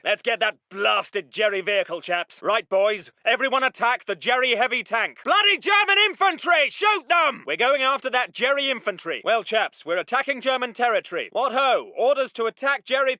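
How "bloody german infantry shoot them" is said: indignant officer's battle cry; angry shouted order. Acted. Officer ordering chaps to shoot German infantry, irate shout.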